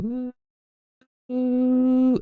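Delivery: high, hollow hooting "ooh" noise; spooky high-pitched oohing; imitated eerie ooh. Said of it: "And it's like".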